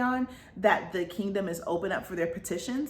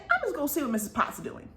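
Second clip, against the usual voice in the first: higher and fast, chirpy resolve; perky Belle voice; 8 semitones higher, faster than usual. Retold or imitated. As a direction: rattle it off brightly, a bit higher. Belle voiced deciding something.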